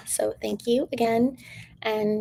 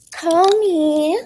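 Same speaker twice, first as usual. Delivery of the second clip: flirty; 7 semitones higher, 6 dB louder than usual. Pretend. Flirty invitation to call her.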